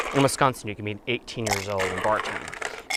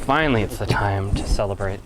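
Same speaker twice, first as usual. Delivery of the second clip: usual speaking voice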